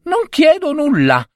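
high piping cricket voice. Acted. Cricket warning the husband in a high voice. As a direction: pipe it up high like a tiny creature. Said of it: "rispose il grillo".

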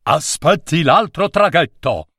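a lightly voiced sailor calling across the water. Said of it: "gridò un marinaio".

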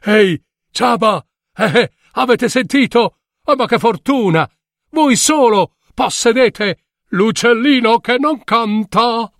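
high, teasing taunt; mocking villagers. A narrator's reading. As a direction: jeer at the cobbler. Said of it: "la gente, ridendo, gli disse". (voce beffarda del popolo)

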